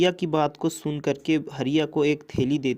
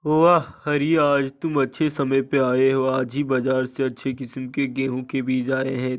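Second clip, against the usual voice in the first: louder, hearty shopkeeper; shopkeeper Lala; 5 dB louder than usual. Acted. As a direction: boom out as the shopkeeper. Lala the shopkeeper greets Hariya. (ज़ोरदार दुकानदार लाला की आवाज़)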